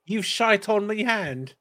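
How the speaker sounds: high, slow British Isles accent, mock disgust; a servant in a British Isles accent, grossed out. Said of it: "I don't know why I went with that accent".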